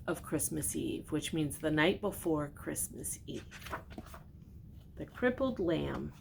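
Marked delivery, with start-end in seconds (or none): none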